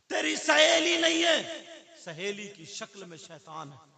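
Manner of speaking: very high, loud, slow wail; a mother warning her daughter about her friend, slow loud wail; mother's wailing warning. Retold or imitated. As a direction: draw it out as an anguished warning.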